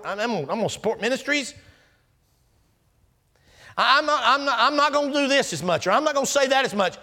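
high, loud, pleading bargainer offering God anything; a churchgoer bargaining with God, high and pleading; bargaining believer. Acted. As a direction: beg the Lord with deals instead of repenting.

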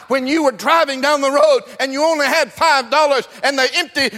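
high, loud, driving revival cadence; full-force preaching. Acted. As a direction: preach it hard, building to a sustained shout.

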